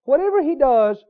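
normal talking voice

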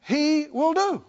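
loud, high, building sermon cadence; a preacher lifting the congregation; full-force preaching. Acted. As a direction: preach it out with rising fire.

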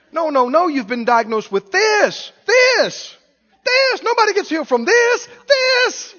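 mock panic leaping very high; hammed-up, squealed insistence on bad news; desperate devil. Devil pushing a diagnosis on the believer, desperate. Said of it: "you will hear the desperation in the devil's voice".

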